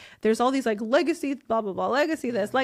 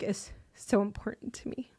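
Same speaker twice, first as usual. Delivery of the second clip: soft, breathless whisper, softer; emotional whisper; 6 dB quieter than usual. Real, not acted. Telling the host what her kids should remember, whispered. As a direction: let it drop to a whisper, moved.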